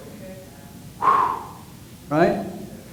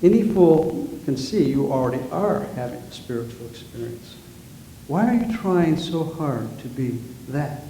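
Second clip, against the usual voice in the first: gentle wisdom; 3 semitones lower, 7 dB quieter than usual. Acted. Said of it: "what does the master teacher say".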